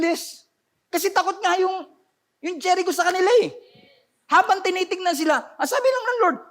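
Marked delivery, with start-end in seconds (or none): none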